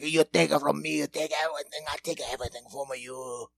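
a hint of old village women laying a curse; old ladies cursing. Old ladies cursing Mussolini in a mild old-woman voice. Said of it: "Just a bunch of old ladies like".

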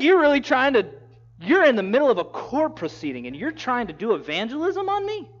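loud, high disbelief; can't believe he's being preached at; incredulous. Acted. Agrippa to Paul in the middle of court, incredulous.

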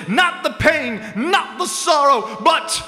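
preacher. Acted. Mock preacher praising ice cream.

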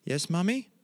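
put-on child's answer. Lost boy answering his mother from the drawer, high and innocent. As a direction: answer sweetly like a sleepy child. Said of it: "He goes".

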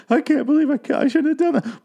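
high, frantic voice full of guilt; guilty killer. Retold or imitated. Agnes shaken after killing them, high frantic guilt. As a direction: imitate her panicking over the killings. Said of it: "where she'll be like".

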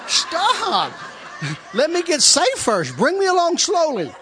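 high, loud and slowed, begging for mercy; high, loud mock plea to a pushy friend; mock pleading. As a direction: beg him to ease off, half laughing.

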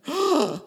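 sharp gasp of pretend shock; mock gasp. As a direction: gasp as if scandalized. Mock shock after his own remark.

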